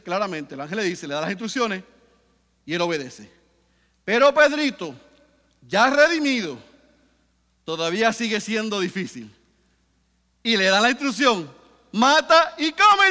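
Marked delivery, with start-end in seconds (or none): 4.0-11.5 loud fervent preaching, voice driving upward; preaching at full force. Acted. 11.9-13.1 loud, slow, high command from heaven; commanding divine voice. Acted. God's command in the vision.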